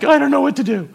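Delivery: high, loud comic helplessness; mock panic. Preacher re-enacts freezing when God opens the door, in mock panic. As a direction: jump up in pitch as if flustered.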